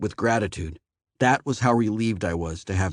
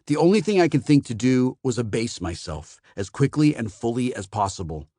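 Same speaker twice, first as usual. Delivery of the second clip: usual speaking voice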